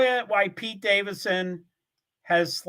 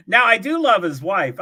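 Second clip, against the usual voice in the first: usual speaking voice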